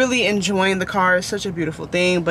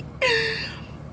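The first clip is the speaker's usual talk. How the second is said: high squealing laugh; 6 dB quieter than usual. Real, not acted. Laughing at herself rambling.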